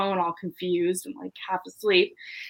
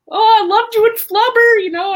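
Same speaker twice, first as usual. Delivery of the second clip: much higher and louder, gushing fan; starstruck gush; 12 dB louder than usual. Retold or imitated. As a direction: squeal it like meeting your idol. Starstruck fan greets Robin Williams.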